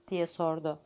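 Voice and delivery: plain reading voice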